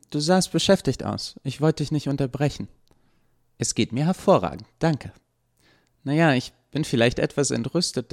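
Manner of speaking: put-on young woman Eva. A narrator's reading. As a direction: play Eva as a bright young woman, gently reproachful.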